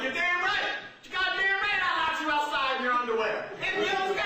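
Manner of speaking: loud, high-pitched dad rant; hammed-up father yelling; shouting defiant father. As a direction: bellow it as a proud, unrepentant father. Father boasting of old punishment.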